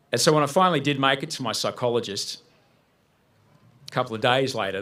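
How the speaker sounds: normal talking voice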